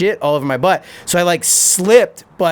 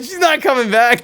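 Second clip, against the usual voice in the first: calling out; 8 semitones higher than usual. Retold or imitated. Friends at the window, a mock shout. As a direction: yell it in disbelief.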